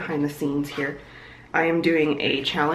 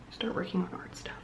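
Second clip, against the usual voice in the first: whisper; 13 dB quieter than usual. Real, not acted. Whispered aside while starting art.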